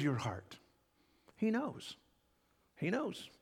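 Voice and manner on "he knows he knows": tender reassurance. Acted. Tender repetition to the hurting.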